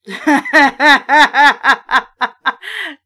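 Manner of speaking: big amused laugh, almost crying; loud real laugh. Real, not acted. Reader laughs at silly sign.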